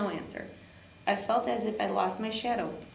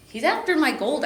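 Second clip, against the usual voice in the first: loud, higher alarmed cry; 6 semitones higher, 9 dB louder than usual. A narrator's reading. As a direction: shout it in alarm. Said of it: "I cried".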